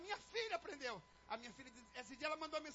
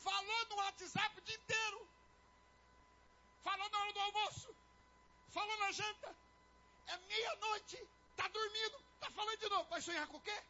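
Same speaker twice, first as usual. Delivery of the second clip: high-pitched, exasperated nagging; 6 semitones higher, 4 dB louder than usual. Acted. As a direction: fire off each complaint in a raised pitch. Acted exasperated spouse.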